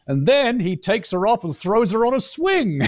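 high-pitched, imitating someone's big exclamation; mock excited retelling. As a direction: quote it with rising, incredulous energy. Mimicking what someone said afterwards.

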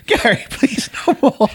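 high, slow, laughing plea; mock desperate pleading. Curry road-tripper begging Gary weeks later, mock desperate pleading. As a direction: beg for mercy, hammed-up and high. Acting a sufferer weeks into curries.

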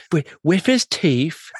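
put-on lightly done Cockney accent, slow; a hint of a Cockney inspector. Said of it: "you gotta do this one of the best Cockney accents".

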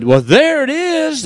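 high, drawn-out exclamation of triumph; slow, soaring cry of discovery; triumphant discovery. Retold or imitated. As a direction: stretch it out high, you've just found it. Finding the lost billfold, retold.

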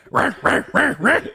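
high, loud imitated cat snarls; mock cat fight noise. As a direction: snarl like a cat beating another. Cat attacking the other cat.

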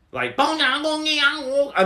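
drawn-out, high-pitched imitation of Mandarin; mock Mandarin accent. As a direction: imitate a man speaking Mandarin, stretched and high. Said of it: "that's how he sounded".

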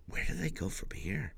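hushed unvoiced whisper. Real, not acted. Host wondering what comes next, in a real hushed whisper. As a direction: breathe the question out like a secret. Wondering about the show's future.

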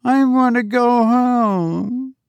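put-on a small girl about to cry; tearful little girl. A narrator's reading. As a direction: littlest girl pleading with the robot teacher, near tears. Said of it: "The face of the littlest girl screwed up".

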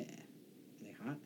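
slow hushed whisper. Real, not acted. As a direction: breathe the question softly. Eager joking question.

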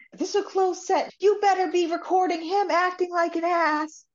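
high-pitched mock yell; retold yelling. Senator's assistant ordering the camera crew, mock yelling. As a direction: call it out high, imitating an indignant assistant. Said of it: "the senator's assistant starts yelling".

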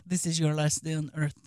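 low and soft, a menacing killer's line; menacing threat. Retold or imitated. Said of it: "They say".

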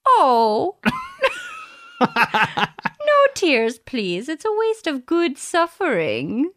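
a badly faked Irish accent, breaking into laughter; hammed-up Irish lilt, cracking up as you go. Friend reading a movie quote in a guessing game, laughing through a bad Irish accent. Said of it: "doing a really bad Irish accent".

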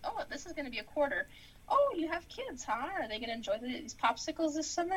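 put-on high, chirpy checkout girl; sweet perky cashier. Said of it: "the checkout says".

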